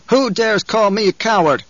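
high, drawn-out outraged challenge; indignant demand. A narrator's reading. Paul confronting the man who said coward, indignant demand. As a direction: demand it, affronted and commanding. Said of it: "Paul demanded".

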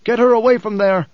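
loud, high-pitched command; urgent cry. A narrator's reading. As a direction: cry out an order in alarm. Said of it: "Paul cried".

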